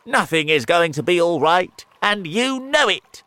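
loud, high outburst of gloom; despairing cry. A narrator's reading. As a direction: insist loudly that all is lost. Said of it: "cried the earthworm".

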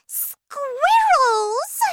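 high, loud squeal, drawn out; piercing, excited Veruca-style yell; excited child's shout. Acted. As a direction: shriek it in spoilt-girl delight. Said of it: "shouted Veruca".

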